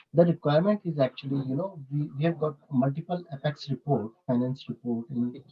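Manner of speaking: soft, barely projected voice; too quiet. Real, not acted. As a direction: explain it quietly, hard to make out. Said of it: "Can you please bit louder".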